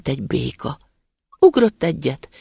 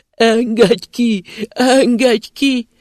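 slow, higher, loud wailing plea; plaintive muffled cry; 9 semitones higher, 7 dB louder, much slower than usual.

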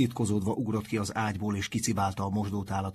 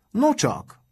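high, loud exclamation; teacher's loud, pitched-up interjection; loud remark; 12 semitones higher, 8 dB louder than usual. A narrator's reading. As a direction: exclaim it loudly to the room. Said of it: "mondta hangosan".

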